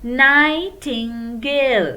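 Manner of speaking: "Nightingale" drawn out slowly, syllable by syllable; slow drilled word. Shown as a demonstration. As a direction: model it slowly so they can follow. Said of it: "Tell louder".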